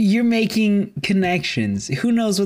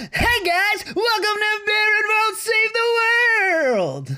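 high, bright announcer greeting; podcast intro. Acted. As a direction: hype up the show opening.

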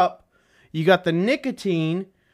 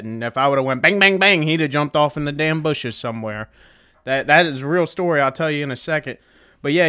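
ordinary delivery

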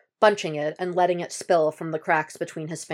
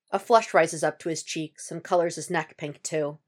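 usual speaking voice

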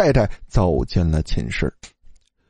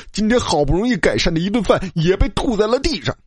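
high-pitched, indignant complaint; angry; 8 semitones higher than usual. A narrator's reading. Roommate mourning his lost meal, angrily indignant. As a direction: complain angrily about the waste. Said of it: "老二愤怒地说".

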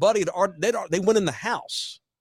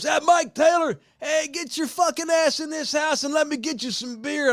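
porch holler; 8 semitones higher than usual. Retold or imitated. Mark calling from the porch.

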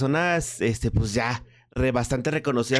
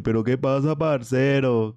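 put-on Colombian accent. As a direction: toss off a friendly Colombian greeting, lightly imitating the accent. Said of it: "no tiene acento colombiano".

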